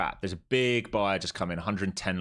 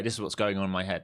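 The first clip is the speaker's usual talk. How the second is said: ordinary talk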